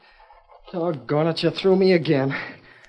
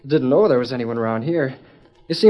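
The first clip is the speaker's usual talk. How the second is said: ordinary talk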